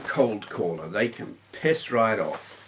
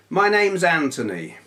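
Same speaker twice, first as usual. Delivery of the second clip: bright, chirpy self-introduction, higher and louder; mock cheery tone; 7 semitones higher, 5 dB louder than usual. Cold caller introducing himself, mock-chirpy. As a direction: announce the name like a breezy salesman. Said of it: "he didn't say it in that tone".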